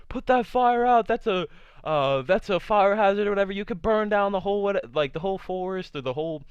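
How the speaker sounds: rescuers yelling across the river, high and loud; mock yell. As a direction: shout it across the water.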